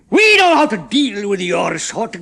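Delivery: slow, loud menacing growl. A narrator's reading. Chemist growling at the prisoners, slow and loud. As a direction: threaten them slowly. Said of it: "growled the chemist".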